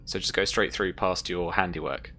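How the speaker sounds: ordinary delivery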